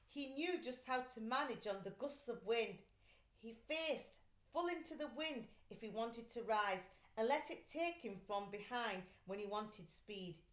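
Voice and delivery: ordinary delivery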